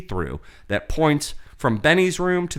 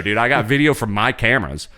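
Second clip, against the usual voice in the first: ordinary talk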